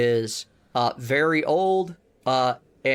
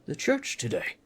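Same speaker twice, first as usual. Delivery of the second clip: put-on friendly but gruff old dwarf; Priest Deno; 2 semitones higher, 3 dB quieter, slower than usual. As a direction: grumble like a busy, kindly old dwarf. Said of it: "he gets this look on his face like".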